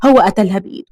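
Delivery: ordinary delivery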